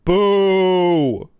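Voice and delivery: loud mock boo. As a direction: shout a boo at them. Booing chat.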